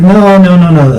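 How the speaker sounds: ordinary talk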